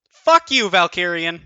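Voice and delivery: loud, high, angry outburst; frustrated yell. Real, not acted. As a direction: curse the bad draw out loud. Frustrated at the card draw.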